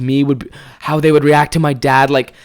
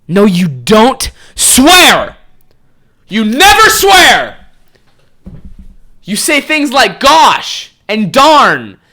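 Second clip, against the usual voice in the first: slow, high, loud mock scolding; scolding kids; 8 semitones higher, 5 dB louder, much slower than usual. Brothers lecturing the narrator on swearing, slow high scold. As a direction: lecture like prim little kids, drawn out. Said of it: "They were like".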